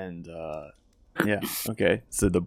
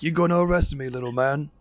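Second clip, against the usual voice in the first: higher, louder mocking challenge; taunting orc; 7 semitones higher, 4 dB louder than usual. Orc taunting Sherwin, mocking challenge. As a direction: step up and sneer down at him. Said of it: "and he's like".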